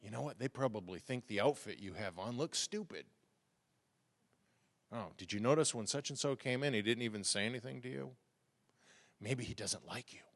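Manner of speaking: soft, secretive whisper of discouragement; whispering demon. Acted. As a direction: tempt them quietly, like a voice on your shoulder. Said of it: "You could whisper in their ear".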